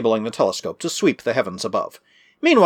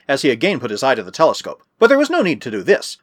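usual speaking voice

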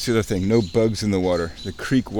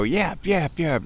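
imitated bird yap; 6 semitones higher, slower than usual. Imitating yappy little birds.

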